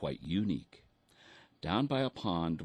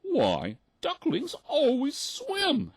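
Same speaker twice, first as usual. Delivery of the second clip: very high, slow, loud mother duck; shocked mother duck; squeaky duck mother aghast; gasping mother duck; 7 dB louder, slower than usual. A narrator's reading. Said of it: "she gasped".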